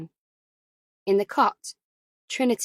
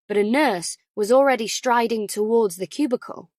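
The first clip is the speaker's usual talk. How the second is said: ordinary delivery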